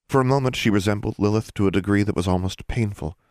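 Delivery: ordinary delivery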